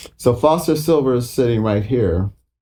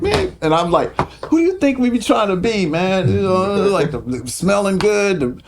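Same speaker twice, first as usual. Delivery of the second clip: pitched way up, defensive and incredulous; high protest; 9 semitones higher than usual. Retold or imitated. Young bassist answering Sly back, pitched way up.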